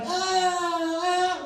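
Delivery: high, drawn-out mock groan; mock audience groan. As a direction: imitate the crowd wincing. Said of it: "y'all gonna be like".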